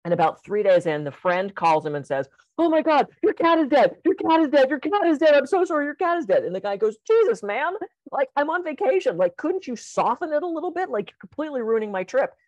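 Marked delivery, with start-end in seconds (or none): 2.6-6.4 high, frantic repeated cry; panicked friend. Acted. Friend phoning about the cat, high frantic blurting. 7.1-12.3 high, exasperated complaint; a lightly voiced fed-up traveller; annoyed vacationer. Man on vacation scolding his friend, high exasperated complaint.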